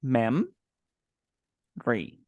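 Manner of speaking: "Mem. Re." as two separate syllables with a long pause between. Shown as a demonstration. Teacher breaks the word down for a student, "Mem. Re." syllable by syllable. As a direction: split it clearly, "Mem", pause, "Re". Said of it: "Okay, two syllables".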